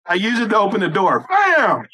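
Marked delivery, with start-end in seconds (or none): 1.3-1.9 shout. Acted. Kicking the door open.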